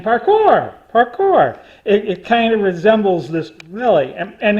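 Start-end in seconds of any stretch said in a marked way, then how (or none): 0.0-1.6 higher, louder chant of parkour; imitating The Office parkour. He quotes Steve Carell's crew chanting parkour, higher and louder. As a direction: echo the sitcom guys' parkour shout, raised. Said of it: "they're saying".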